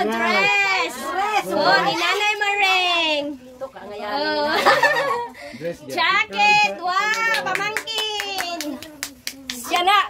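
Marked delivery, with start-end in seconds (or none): 4.5-5.9 a bright, high burst of laughter; delighted high laugh; high giggle. Real, not acted. Laughing at gift.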